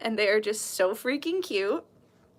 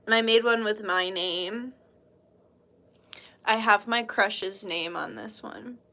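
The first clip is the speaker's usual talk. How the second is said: usual speaking voice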